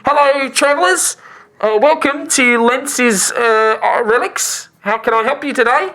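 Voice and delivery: high, put-on voice of an eager trader; fawning shopkeeper. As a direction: welcome customers effusively, pitched well up.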